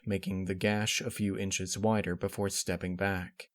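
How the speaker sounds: ordinary delivery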